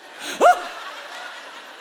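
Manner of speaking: breathy high burst of laughter; real high laugh. Real, not acted. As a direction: laugh out in delight at the scene. Laughing at drive-thru drama.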